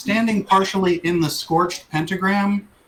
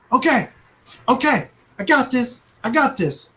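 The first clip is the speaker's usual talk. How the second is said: 1 gnome eager; 5 semitones higher than usual. Acted. Gnome reassures captain.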